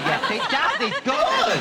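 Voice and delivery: delighted surprise. Retold or imitated. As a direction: pitch climbs high, delighted. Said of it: "You go".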